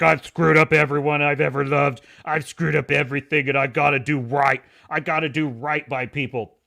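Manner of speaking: mock brooding Butcher. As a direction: ham up a guilt-ridden confession. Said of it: "He's like".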